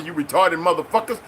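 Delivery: ordinary delivery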